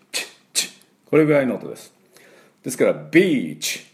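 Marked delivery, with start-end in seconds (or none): 0.1-0.7 unvoiced kiss チュ; blown kiss. Shown as a demonstration. Teacher demonstrating the blown-kiss チュ. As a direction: blow a kiss on チュ. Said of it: "投げキッスをする時のチュ".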